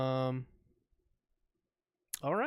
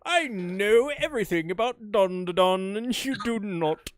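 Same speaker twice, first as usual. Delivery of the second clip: loud, lofty gloating laugh; booming arrogant alien overlord; 4 semitones higher, 6 dB louder than usual. Pretend. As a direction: lord it over the puny humans. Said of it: "on his high horse".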